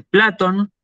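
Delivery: "Platón" said PLA-ton, first syllable stressed. Shown as a demonstration. Teacher gives the Greek form of "Platón", stressed PLA-ton. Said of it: "en griego se dice".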